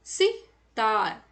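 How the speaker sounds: Sitar drawn out slowly, syllable by syllable; slow demo pronunciation. Shown as a demonstration. Teacher showing children how to say it, slowly. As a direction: spell out sitar slowly for the class. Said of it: "इसका उच्चारण किस प्रकार होता है?".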